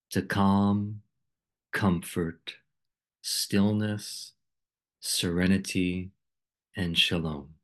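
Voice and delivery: calm, unhurried meditation voice with long pauses; guided meditation. Acted. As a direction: guide listeners gently into stillness, phrase by phrase.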